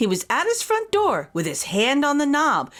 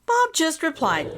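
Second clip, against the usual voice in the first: ordinary delivery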